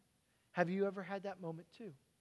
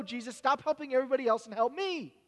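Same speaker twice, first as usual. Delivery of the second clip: loud, pushy, higher pleading to hurry; a father's frantic let's go; impatient urging; 5 semitones higher, 9 dB louder than usual. Acted. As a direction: hurry him along like a desperate father.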